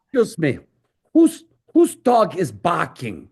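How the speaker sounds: put-on a light German accent, asking politely but pointedly. Bodo asks the crowd about the barking dog, in a mild German accent. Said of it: "with his German accent".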